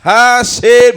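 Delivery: loud, high-pitched comedian impression; Bernie Mac. Comic rereads the quote as an adult, loud high impression. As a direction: belt it out as a brash adult comic. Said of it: "reread it as Bernie Mac's voice".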